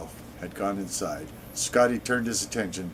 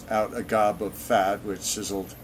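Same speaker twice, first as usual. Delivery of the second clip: usual speaking voice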